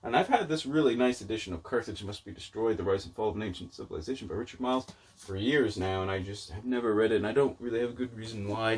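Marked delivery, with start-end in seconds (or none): none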